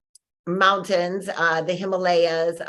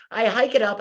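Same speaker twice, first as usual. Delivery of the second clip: usual speaking voice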